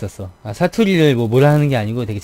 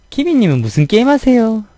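put-on high, loud Busan-accented question; a Busan player asking cheerfully; Busan gamer; 9 semitones higher, 5 dB louder than usual. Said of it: "부산이나 그쪽". (높고 큰 부산 억양 질문)

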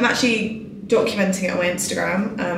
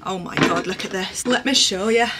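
ordinary delivery

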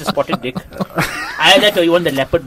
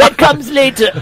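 much higher, playful delivery; high-pitched line; 10 semitones higher than usual. Acted. Teasing the others about what comes next, high-pitched. Sets up a Bane quote.

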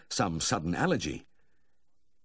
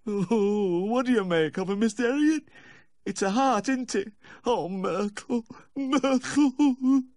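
high, loud tearful wailing; heart-rending wail; 6 dB louder, slower than usual. A narrator's reading. Distraught dog owner wailing to the vet over his pet, heart-rending wail. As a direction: wail it out, drawing out the dog's name. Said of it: "A heart-rending wail sounded in my ear".

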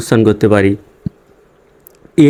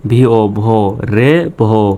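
ordinary talk, nothing marked

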